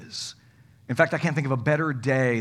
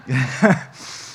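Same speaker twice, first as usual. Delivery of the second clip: laugh; 3 semitones lower than usual. Real, not acted. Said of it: "that's funny".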